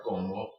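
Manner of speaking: como, neither O leaning harder; even stress. Shown as a demonstration. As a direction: keep both syllables of como level. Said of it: "two O's equally as hard".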